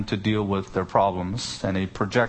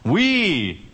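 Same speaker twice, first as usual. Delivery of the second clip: "We" drawn out, much higher and louder; emphatic WE; 8 semitones higher, 4 dB louder than usual. Shown as a demonstration. Preacher showing how English does emphasis, emphatic "We". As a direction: hit "We" with a big swell in pitch and volume. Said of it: "do that with a volume increase".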